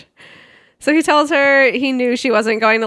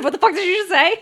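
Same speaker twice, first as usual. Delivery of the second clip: higher, fast, incredulous snap; quick mock-outraged retort; outraged disbelief; 8 semitones higher, faster than usual. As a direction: fire it back in shocked disbelief. Retelling own outraged reaction.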